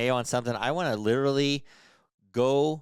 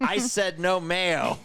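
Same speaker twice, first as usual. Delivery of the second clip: drawn-out, high-pitched mock yell; imitated outraged shout, stretched out; retold angry yell; 8 semitones higher, much slower than usual. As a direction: yell it slowly, imitating a furious drive-thru customer. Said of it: "and he yells".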